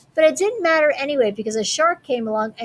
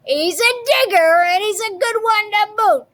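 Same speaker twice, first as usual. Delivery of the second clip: higher, louder pirate call; pirate yelling to crew; 10 semitones higher, 4 dB louder than usual. A narrator's reading. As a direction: shout it across the deck. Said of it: "yelled back to his crew".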